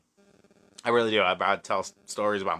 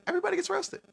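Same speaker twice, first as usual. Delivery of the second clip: "Everybody gets roasted" said much higher; high emphatic; much faster than usual. Acted. Host to chat, repeating "Everybody gets roasted" high and emphatic. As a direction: lift "Everybody" high in mock outrage. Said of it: "repeats phrase higher".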